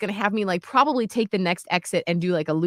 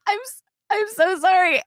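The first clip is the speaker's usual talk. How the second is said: high-pitched frantic apology; acted-out wail of panic, near tears; full-blown panic; 5 dB louder than usual. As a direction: plead in a panic, voice shooting up. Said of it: "now in full-blown, like, panic mode".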